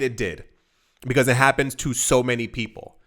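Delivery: ordinary talk, nothing marked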